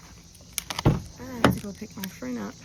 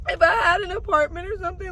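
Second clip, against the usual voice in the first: tearful; 9 semitones higher, faster than usual. Real, not acted. Still crying about the cat.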